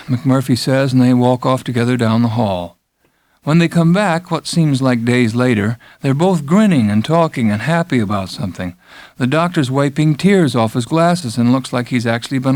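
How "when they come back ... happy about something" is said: usual speaking voice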